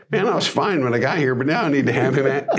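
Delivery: high, quick comic plea, breaking toward a laugh; complaining crowd member. Acted. Picturing guys pressing Jesus for healing, high comic complaint. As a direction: imitate a needy guy, pitch climbing sharply by the end. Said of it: "I'm just picturing the guys like".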